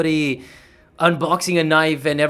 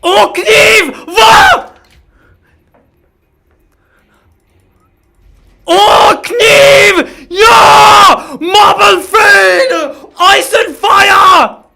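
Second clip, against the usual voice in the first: screaming hype, much higher and louder, stretched slowly; anomaly impression; 14 dB louder, much slower than usual. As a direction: scream the knife reveal in a put-on voice. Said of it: "that's my abnormally impression".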